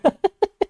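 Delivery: high burst of laughter; real amused laugh. Real, not acted. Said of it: "laughs at own attempt".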